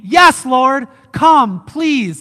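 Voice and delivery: loud, high cry of welcome; eager plea. Acted. Believer welcoming Christ's return, eagerly pleading. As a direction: call out eagerly to the Lord. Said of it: "our demeanor ... should always be".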